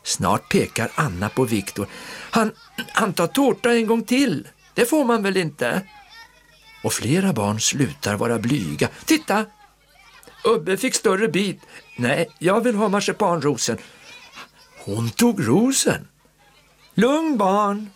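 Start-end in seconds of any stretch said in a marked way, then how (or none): 2.3-5.8 put-on high little child voice; tattling child. A narrator's reading. Child telling the aunt about cake, high tattling voice. 9.0-16.1 put-on high childish voices; squabbling children. A narrator's reading. Kids arguing over cake at the table, high whine. 16.9-17.9 high, soothing aunt voice; aunt calming kids. A narrator's reading. Aunt calming the squabbling children, high and soothing. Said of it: "säger faster Fiffi".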